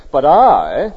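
"I" in "But I" said loud and firm; stress on I. Shown as a demonstration. Preacher voicing God's words, punching "I" loudly. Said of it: "is very emphatic".

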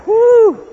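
high, loud hot-weather whoop; mock heat woo. Shown as a demonstration. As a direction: whoop as if scorched. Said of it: "it's still hot".

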